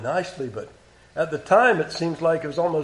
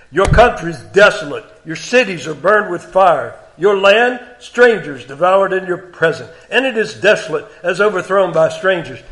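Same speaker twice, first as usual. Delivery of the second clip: raised, deliberate King James reading; solemn scripture reading; 2 semitones higher, 5 dB louder than usual. Acted. Preacher reading God's words to congregation, raised. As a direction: read it as God's complaint against Israel.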